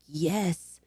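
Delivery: hushed, mock-blissful mutter; mock entranced. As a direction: say it softly, like giving in to temptation. Acts out being enticed by porn.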